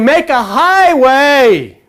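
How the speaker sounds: slow, stretched call of make a highway; slow, ringing call; drawn-out call. Pretend. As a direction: draw out highway like a proclamation. Voice crying within you.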